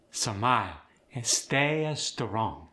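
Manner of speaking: slow Khmer accent, vowels stretched and added; Khmer-accented, clusters split by extra vowels. Shown as a demonstration. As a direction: draw it out slowly, Khmer style. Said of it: "Vowels are elongated quite often".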